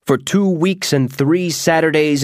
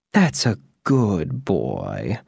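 lower, slow, soft fond witch; 5 semitones lower, 4 dB quieter, much slower than usual. Acted. Witch praising the mouse after laughing, slow and soft. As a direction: purr it slowly like praising a pet. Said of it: "the witch said".

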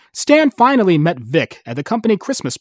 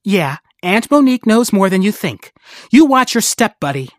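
warning; 6 semitones higher, 5 dB louder than usual. Acted. Monique warns the Ant King.

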